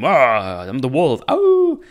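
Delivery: loud growl rising into a high howl; hammed-up wolf howl, very high at the end; mock angry wolf. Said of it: "because he's so angry all the time".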